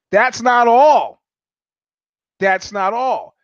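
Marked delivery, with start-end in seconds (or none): 0.1-1.2 loud, high, drawn-out announcement; big dramatic storyteller's declaration; emphatic. Real, not acted. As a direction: slowly punch out each word, raised and loud. Builds suspense in the injury story.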